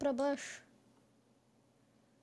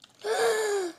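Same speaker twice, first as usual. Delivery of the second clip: gasp; 9 semitones higher, 5 dB louder than usual. Real, not acted. Boss attack surprises player.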